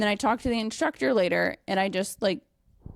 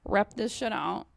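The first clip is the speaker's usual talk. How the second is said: ordinary delivery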